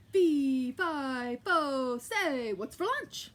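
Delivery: loud, hammed-up giant call; booming giant. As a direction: boom it down the hallway.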